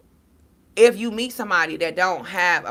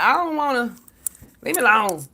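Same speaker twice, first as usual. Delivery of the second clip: higher-pitched protest, imitating herself; mock whiny refusal; 5 semitones higher, 4 dB louder than usual. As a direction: whine it like a kid refusing. Retelling her refusal to daughter.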